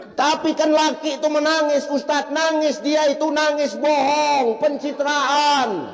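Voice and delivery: objecting wife. Acted. Congregant objects to preacher.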